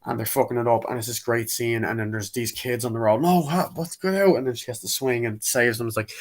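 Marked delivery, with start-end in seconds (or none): 3.2-4.4 mock alarmed. Startled at kids on road.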